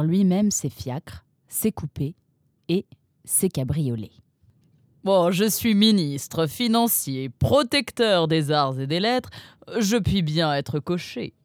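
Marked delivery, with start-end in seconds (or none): 5.0-11.3 slow, pompous minister; grand, lent et pompeux; Donsol. A narrator's reading. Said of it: "avait-il répondu à la maman". (Donsol répond à la maman, pompeux et lent)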